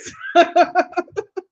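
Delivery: a short real laugh that rises; light rising chuckle; amused laugh. Real, not acted. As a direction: laugh at the comment. Laughing at a viewer's comment.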